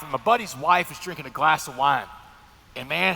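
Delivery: usual speaking voice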